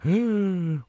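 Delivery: sharp high mock gasp; dramatic gasp. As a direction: gasp in fake horror.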